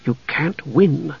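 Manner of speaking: slow, hushed warning; soft. A narrator's reading. Half-elf dissuades knight from fighting, soft. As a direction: say it softly and slowly. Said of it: "Tanis repeated softly".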